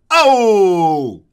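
loud comic "Oh!" after the joke; punchline shout. Acted. Roaster caps a nursery-rhyme joke with a loud Oh. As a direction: belt out a hammy "Oh!" like a rimshot.

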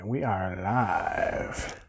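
slow, low robotic drone. Pretend. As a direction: speak like a machine coming online. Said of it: "in my robot voice".

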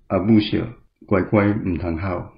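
usual speaking voice

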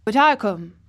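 put-on loud, stubborn old countryman; a touch of grumpy defiance; grumpy, obstinate. A narrator's reading. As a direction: insist on it doggedly. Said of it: "with grumpy obstinacy".

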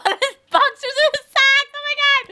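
high, helpless laughter through the words; laughing. Real, not acted. Teasing partner about his dress shirt and socks, laughing. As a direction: talk through rising giggles.

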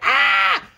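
one loud screech; mimicked infant yell; imitated baby scream. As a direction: let out a short, loud mock shriek. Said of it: "screaming and crying".

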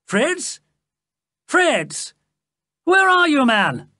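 high-pitched call into the distance. A narrator's reading. The king calls Fritz from the trees, calling out. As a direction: call for someone out of sight, peaking high and loud. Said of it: "a voice called out from the trees".

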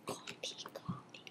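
whispered coffee, coffee; whispering. Real, not acted. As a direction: breathe it without voice.

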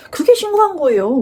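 high-pitched mock accusation; imitating scolding officer. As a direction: act out an exasperated officer's rebuke. Police scolding her, acted out.

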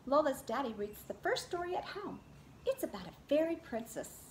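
lively read-aloud picture book voice; reading to children. Acted. Teacher reads Lola Loves Stories to her class, in a lively storybook voice. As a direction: read it to a circle of little kids.

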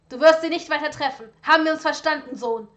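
loud, furious bark; angry bark. Acted. Father forbids son to see the woman, loud furious bark. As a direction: bark the order at him. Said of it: "blaffte Lucius Draco wütend an".

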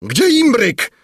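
very high, loud cry; king's shout. A narrator's reading. King calling for the kettle as princess faints, shouting. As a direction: shout it out in alarm. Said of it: "krzyknął król".

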